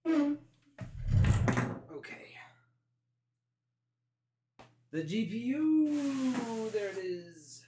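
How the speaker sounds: high, loud, slow announcement; excited reveal. Acted. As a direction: hype up the big reveal. Dramatic reveal of GPU.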